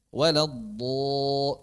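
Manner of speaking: slow drawn Waladdo- said wrongly; wrong reading. Shown as a demonstration. Teacher shows students the wrong reading, slow drawn. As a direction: stretch Waladdo- slowly, as the wrong example. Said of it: "nggak gini kan?".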